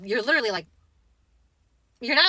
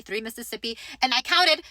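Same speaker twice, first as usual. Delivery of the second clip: ordinary talk, nothing marked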